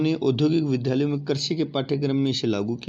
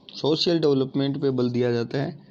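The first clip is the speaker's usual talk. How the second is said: ordinary talk, nothing marked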